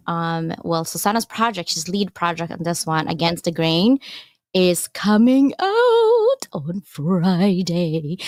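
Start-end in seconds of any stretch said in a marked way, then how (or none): 4.5-8.2 slow, drawn-out spooky voice; spooky Halloween voice. Pretend. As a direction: stretch it out like a Halloween ghost. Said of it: "I sound like Halloween".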